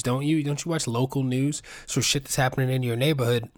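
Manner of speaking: ordinary talk, nothing marked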